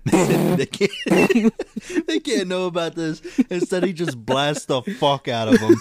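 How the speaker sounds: high villain voice with laughs; evil villain voice. Acted. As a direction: play a cackling bad guy.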